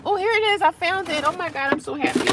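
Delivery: high, delighted squeal of relief; excited find. Real, not acted. Finds her lost tripod.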